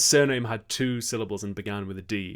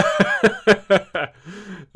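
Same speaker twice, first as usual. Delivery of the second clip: loud laugh; 10 semitones higher, 11 dB louder than usual. Real, not acted. Still laughing at Nando's joke.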